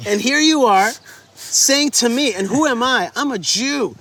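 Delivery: rising disbelief, pressing each word; indignant. Retold or imitated.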